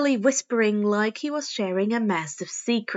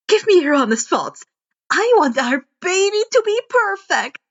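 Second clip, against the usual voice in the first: put-on high, loud, bubbly girl inventor, climbing at the end; bursting to show off her work; Hatsume voice; 7 semitones higher, 6 dB louder than usual. Inventor eager to show her friend the sheaths, high and bubbly.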